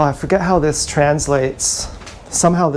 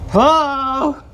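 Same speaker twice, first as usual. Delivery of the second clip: calling hello; 9 semitones higher than usual. Acted. Calling out again, higher.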